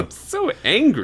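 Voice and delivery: high-pitched teasing echo of a rant; mock anger. Co-host teasing the fuming dad, high mock-angry voice. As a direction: squeak it out in pretend outrage.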